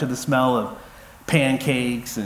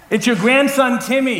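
higher and louder, calling to a grandma hard of hearing; yelling into the phone; 8 semitones higher, 5 dB louder than usual. Retold or imitated. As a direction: shout each word so grandma catches it. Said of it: "you're yelling at your grandmother".

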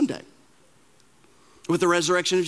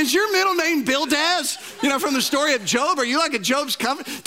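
mock outrage at a gloomy friend; comic incredulous retort; 8 semitones higher than usual. Incredulous joke, very high and loud.

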